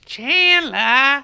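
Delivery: put-on loud, high Jersey girl screech of a name; Janice off Friends. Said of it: "like the Janice off Friends kind of voice".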